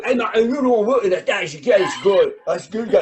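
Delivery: mumbled mock British comic; British accent. British comics pestering host about States work, mock British accent. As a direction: imitate a hard-to-follow British accent. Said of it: "That's what they sound like".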